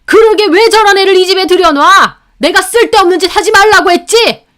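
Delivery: father-in-law scolding his wife; thundering rebuke. A narrator's reading. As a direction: roar a rebuke. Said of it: "불호령하는 아버님".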